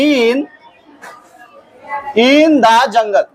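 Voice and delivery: very slow, word by word with pauses; slow dictation. Real, not acted. Teacher writing out an example sentence for the class, slow dictation. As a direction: dictate it slowly, piece by piece.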